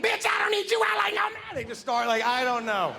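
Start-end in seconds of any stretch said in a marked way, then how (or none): 0.0-1.5 mock furious yell.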